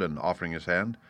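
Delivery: usual speaking voice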